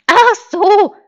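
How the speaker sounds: high, loud sheep exclamation; naive sheep. Acted. Sheep finally understanding the wolf, high bleat.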